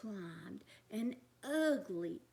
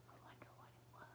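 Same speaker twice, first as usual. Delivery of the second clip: spooky whisper; 25 dB quieter, much faster than usual. A narrator's reading. Spooky thunder moment.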